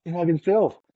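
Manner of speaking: high-pitched giddy exclamation; thrilled disbelief, voice jumping up; starstruck thrill. Retold or imitated. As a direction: burst out with retold fan excitement. Repeats the starstruck thought, thrilled.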